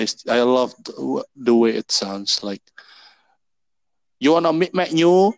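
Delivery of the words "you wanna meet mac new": playful Singlish take on the menu, higher and louder; mock Singlish. Joking with a friend about Singlish food names, mock accent. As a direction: tease it out in a Singlish lilt.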